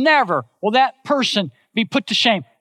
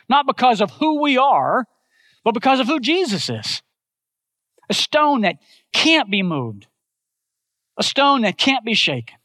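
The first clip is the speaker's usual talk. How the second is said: higher, building proclamation of the living stone; rising preaching; 4 semitones higher than usual. Acted. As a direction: preach it with swelling conviction.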